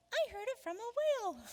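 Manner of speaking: high-pitched little creature; put-on high cartoon voice; shrimp voice. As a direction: squeak it out like a tiny shrimp.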